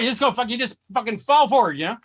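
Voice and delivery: ordinary talk, nothing marked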